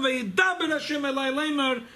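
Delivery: high-pitched, ringing quoted proclamation; sudden proclamation. Acted. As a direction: call out the verse as a sudden announcement. Sudden divine speech after long silence.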